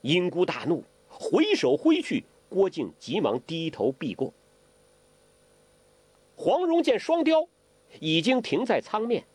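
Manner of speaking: ordinary delivery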